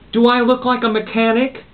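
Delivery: high sharp retort; snapping. Acted. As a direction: snap back at her, irritated. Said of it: "snapped Ethel".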